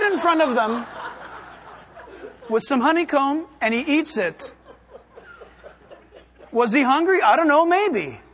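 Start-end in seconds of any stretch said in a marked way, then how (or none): none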